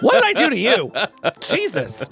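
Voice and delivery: high, loud, laughing protest; playfully outraged, through laughter; mock indignation. Mock quarrel over birthday disagreement.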